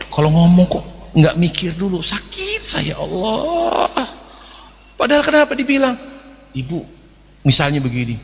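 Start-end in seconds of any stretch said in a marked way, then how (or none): 0.1-3.9 a touch of a wounded woman's complaint; hurt woman. Acted. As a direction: play the offended listener, lightly. (perempuan tersinggung, dibuat-buat ringan)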